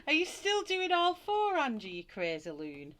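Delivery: high, loud outburst through laughter; amused exclamation. Real, not acted. Teasing Angie over doing all four, laughing exclamation. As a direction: tease a friend loudly, breaking into laughs.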